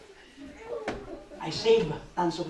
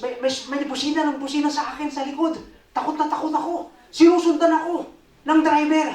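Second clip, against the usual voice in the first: agitated retelling; 11 semitones higher, 7 dB louder than usual. Retold or imitated. Frantic retelling of car breakdown.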